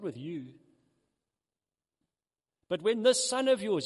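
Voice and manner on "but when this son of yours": put-on angry older brother.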